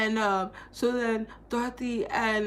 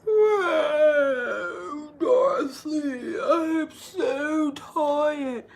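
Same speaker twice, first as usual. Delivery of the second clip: higher, slow, whiny drowsy lion voice; sleepy lion; 6 semitones higher, 4 dB louder, much slower than usual. Retold or imitated. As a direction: drag it out like a drugged cowardly lion. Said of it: "the lion is like".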